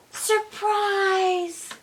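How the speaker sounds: high, loud joyful shout; yelled surprise. A narrator's reading. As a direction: burst out like a party surprise. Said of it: "the tree yelled".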